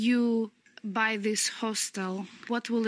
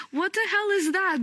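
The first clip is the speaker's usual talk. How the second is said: high, disbelieving outburst; incredulous; 8 semitones higher than usual. Retold or imitated. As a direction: sound baffled and put out. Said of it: "And I was like".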